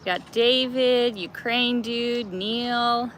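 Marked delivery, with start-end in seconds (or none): none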